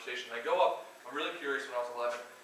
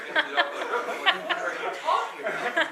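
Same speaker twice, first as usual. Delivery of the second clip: much higher, louder, slow and laughing; laughing disbelief; 9 dB louder, slower than usual. Retold or imitated. Employee recalling his reaction to the boss, slow high laughing squeal. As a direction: stretch it out through laughter, squeaking.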